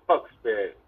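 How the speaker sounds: normal talking voice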